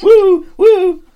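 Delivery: loud, high-pitched whoops; two hooted woos; mock whoop. Co-host cheering the joke about being high, mock whoop.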